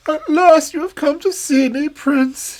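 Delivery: put-on a vain, scheming old fairy crone; shrill-free high hag of a fairy; wicked old fairy. Old fairy voiced in story.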